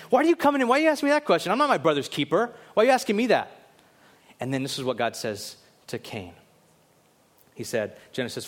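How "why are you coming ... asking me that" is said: high, loud mock indignation; indignant retort. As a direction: push back defensively, pitch jumping up. Acting out Cain's defensive retort to God.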